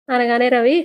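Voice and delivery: usual speaking voice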